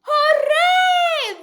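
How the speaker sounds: high loud hooray; cheer. Acted. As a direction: celebrate the fire going out. Fire is out.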